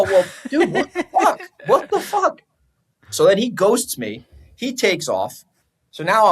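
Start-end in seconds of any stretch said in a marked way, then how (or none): 0.0-2.4 high-pitched exasperated mock outburst; retold exasperation. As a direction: retell your disbelief, voice leaping high. Retold confrontation over spent money.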